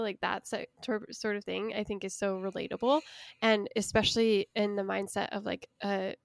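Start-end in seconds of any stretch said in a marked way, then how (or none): none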